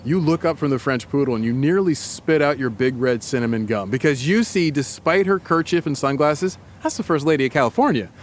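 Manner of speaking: lightly voiced guided-scene narration, calm and even; story narration. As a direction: paint the scene for her, unhurried. Narrates immersive scene to co-host.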